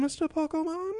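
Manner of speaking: high, soft questioning sing-out; imitated waitress. Waitresses offering regular customer ice cream, high soft imitation. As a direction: pitch it way up, softly coaxing. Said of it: "they're like".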